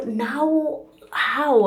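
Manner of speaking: high, loud cry of disbelief; retold cry of dismay; dismayed outcry. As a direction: blurt it out high and loud, stunned. Retold dismayed reaction to bad news.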